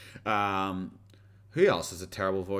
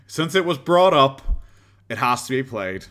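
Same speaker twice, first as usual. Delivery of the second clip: declaring; 4 semitones higher, 8 dB louder than usual. Pretend. Mock-solemn announcement before playing clip.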